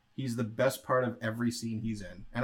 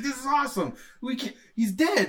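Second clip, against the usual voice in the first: excited henchman; 12 semitones higher than usual. Retold or imitated.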